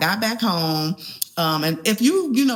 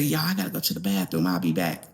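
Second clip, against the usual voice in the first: much lower, quick sick mumble; queasy low voice; 12 semitones lower, 4 dB quieter, faster than usual. Retold or imitated. Telling her friends she needs the bathroom, low and hurried. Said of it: "And I said".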